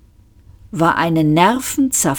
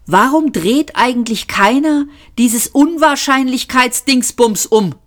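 sudden idea; 6 semitones higher than usual. A narrator's reading. Arthur has sudden idea amid panic.

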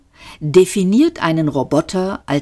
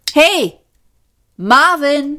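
calling out; 11 semitones higher, slower than usual. Acted. Calling the robot over.

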